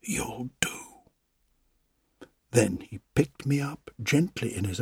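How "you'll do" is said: hushed, breathy whisper of wonder. A narrator's reading. Old carver whispers to his new puppet, hushed and awed. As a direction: whisper it softly, full of awe. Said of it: "he whispered".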